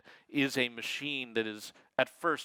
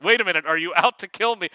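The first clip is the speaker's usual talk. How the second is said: quick, louder alarmed user questioning the assistant; higher, hurried mock alarm; acted worry; 4 semitones higher, 9 dB louder, faster than usual. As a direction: blurt out a mock-worried challenge. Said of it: "to kind of pretermit the worries".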